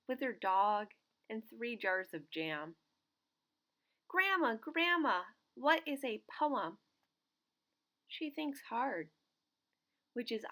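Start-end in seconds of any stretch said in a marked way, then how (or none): 4.1-6.8 high, louder excited call; child eagerly calling his grandmother. Acted.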